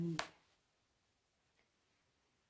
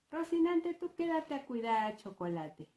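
high, louder call that settles down; boy calling out; 7 semitones higher, 4 dB louder than usual. Acted. Toñito tells Rocinante to watch Chocolate, calling out high. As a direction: instruct a companion in a raised child's voice.

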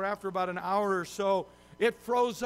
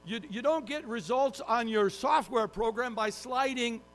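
normal talking voice

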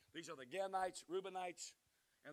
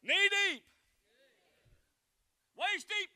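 much higher, ringing call to the crowd; rising preacher call; 8 dB louder, slower than usual. Acted. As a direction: lift the congregation up with each step. Escalating call and response.

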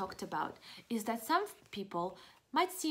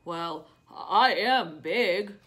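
lower, louder, slow giant voice; sad big whale; 4 semitones lower, 8 dB louder, much slower than usual. Acted. Reading to kids, whale admitting his size. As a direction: rumble it out slowly.

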